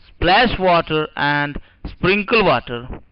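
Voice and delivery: both phrases drawn out slowly; slow dictation. Shown as a demonstration. Teacher reading the words aloud for students to write, slowly. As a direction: read it out slowly for writing. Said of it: "पहले आप लिख लीजिए".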